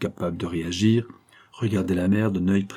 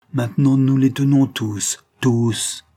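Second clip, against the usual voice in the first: louder and higher, biting down on tenons; a villainess exulting over her trapped victims; triumphant cry; 5 semitones higher, 5 dB louder, slower than usual. A narrator's reading. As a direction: crow that she has them all now.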